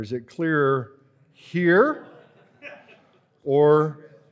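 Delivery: mock optometrist asking; eye doctor voice. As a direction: play the eye doctor, rising. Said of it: "It's like at the eye doctor".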